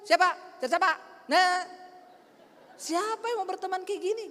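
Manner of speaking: high, snappish mock challenge; fierce as a dog. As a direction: imitate a snarly, unfriendly person. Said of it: "Kayak anjing galak kan".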